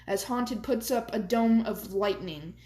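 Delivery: usual speaking voice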